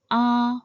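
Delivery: AH held high and even, like for the dentist; high, level AH, no rise or fall; first tone. Shown as a demonstration. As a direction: say AH on a steady high pitch. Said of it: "starts relatively high and stays even and constant".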